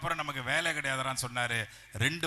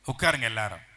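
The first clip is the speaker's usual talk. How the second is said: usual speaking voice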